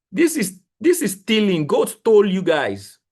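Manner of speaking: high, loud mock outrage; outraged. Real, not acted. Trader to his viewers about the market, outraged and loud. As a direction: cry it out like a robbery.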